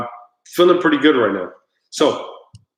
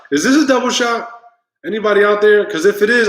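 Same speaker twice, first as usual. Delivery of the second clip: much higher and louder, asking the viewers; calling out to chat; 8 semitones higher, 4 dB louder than usual. Real, not acted. Asking the live chat about the double shot, a high loud call. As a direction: call out to anyone listening.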